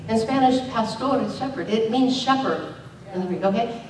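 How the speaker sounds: usual speaking voice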